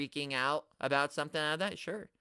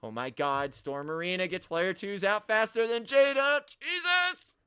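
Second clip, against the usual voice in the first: building from normal to a high-pitched cry; mock freak-out; 4 semitones higher than usual. Streamer parodies losing sleep over action figures, mock freak-out. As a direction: climb steadily into exaggerated panic, peaking on Jesus.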